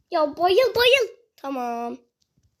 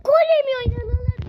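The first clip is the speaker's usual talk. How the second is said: frustrated; 8 semitones higher, 3 dB louder than usual. Real, not acted. Keeper keeps saving.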